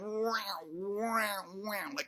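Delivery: mournful little mewing wow, trailing off; sad cat stomach noise. Retold or imitated. Speaker mimics his own stomach's sad cat noise. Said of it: "kind of sad cat noise".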